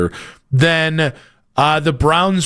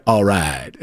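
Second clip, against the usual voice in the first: McConaughey impression; 11 semitones lower than usual. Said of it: "Give me a McConaughey".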